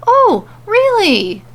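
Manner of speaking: high, slow, drawn-out sarcastic "Oh, really?"; mocking disbelief. A narrator's reading. Mandy mocking the king in his plastic crown, slow sarcasm. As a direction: stretch it out in mock disbelief.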